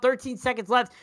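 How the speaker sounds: ordinary talk, nothing marked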